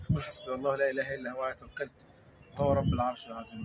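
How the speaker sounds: low, even recitation of verses; murmured recitation. Acted. Host reciting Quran verses before approaching the man, low recitation. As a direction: recite it low and steady, like a private prayer.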